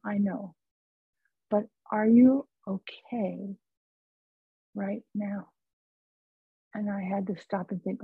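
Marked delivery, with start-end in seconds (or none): 0.1-5.5 gently ask, letting each word settle; very calm. Retold or imitated. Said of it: "this voice was very calm".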